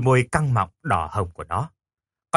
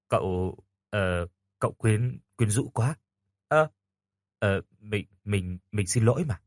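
softer, halting apology; sheepish apology; 4 semitones lower, 5 dB quieter, slower than usual. Acted. Boy apologizes to the girl, softer and halting. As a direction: mumble it, ashamed.

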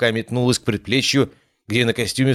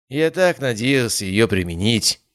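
ordinary delivery

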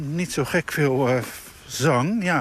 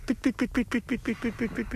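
high, soft piping pipit call; whistled piet piet like a pipit; bird call imitation; 9 semitones higher, 6 dB quieter than usual. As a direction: chirp it like a small bird.

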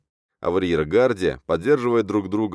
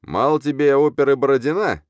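high, scornful raised voice; loud mockery; 8 semitones higher, 4 dB louder than usual. A narrator's reading. Editor showing off the khan to his staff, jeering. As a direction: jeer at him for the crowd.

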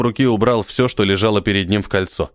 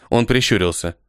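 ordinary delivery, nothing marked

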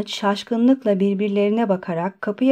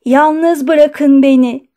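slow, loud cry to be left alone; angry shout; 5 semitones higher, 7 dB louder, much slower than usual. A narrator's reading. Doctor to his two visitors, shouting angrily. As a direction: shout it out, higher and much louder. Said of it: "diye bağırdı".

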